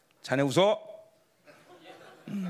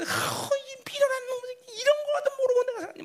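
acted high-pitched wail of regret; reenacted agonized repentance; anguished self-reproach; 13 semitones higher than usual. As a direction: berate yourself in a high, lamenting cry. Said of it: "내 이마를 막 땅에다 치면서".

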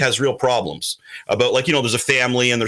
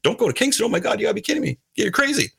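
tag; 8 semitones higher, faster than usual. Retold or imitated. Retelling lawyer's disbelieving objection.

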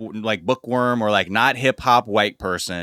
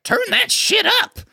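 much higher, slow put-on old lady voice; a granny demanding the music, high and drawn out; sassy granny; 3 dB louder than usual. Older woman dancer telling the crew to turn it up, high slow old lady voice.

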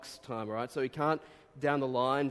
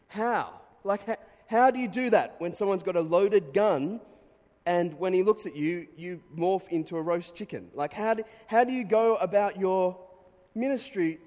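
higher and louder, how could anyone do that; incredulous outburst; 7 semitones higher, 9 dB louder than usual. Real, not acted. Preacher marvelling to the congregation, incredulous and loud. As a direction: burst out in disbelief at the story. Amazed at calm under a gun.

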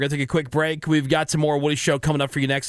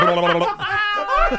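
mock chatter; 3 dB louder, much slower than usual. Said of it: "I was like".

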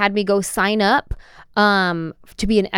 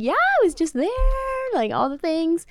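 mock excited; 11 semitones higher than usual. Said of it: "I'm like".

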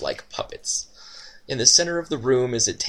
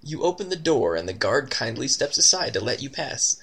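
usual speaking voice

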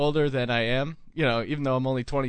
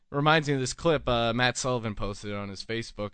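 usual speaking voice

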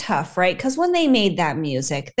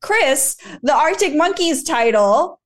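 mock indignation; 7 semitones higher than usual. Retells own mock-outraged reaction.

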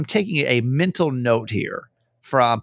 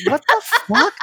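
hoch und laut, halb lachend; ungläubig aufkreischen, lachend; shrieking laughing disbelief; 4 dB louder than usual. Retold or imitated. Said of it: "And I was like".

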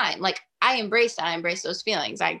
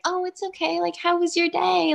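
overly nice pushover, mocking; high, breathy-sweet mock of the accommodating girl; mock sweet girlfriend; 11 semitones higher than usual. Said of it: "I was not in the mood to be like".

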